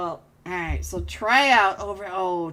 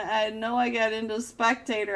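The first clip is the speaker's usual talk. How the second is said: ordinary delivery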